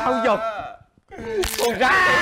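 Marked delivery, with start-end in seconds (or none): 1.6-2.2 shouted urging. Acted. Urging him to endure.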